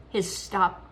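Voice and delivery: plain reading voice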